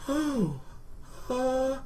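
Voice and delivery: tiny high, soft "oh, uh"; quivering loser whimper. Retold or imitated. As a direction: whimper feebly in mock fear. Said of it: "I become a gigantic quivering loser".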